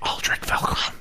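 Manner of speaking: hushed, voiceless whisper; secretive whisper of a name. Pretend. Host announcing the caller to co-hosts, whispering. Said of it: "Why are we whispering?".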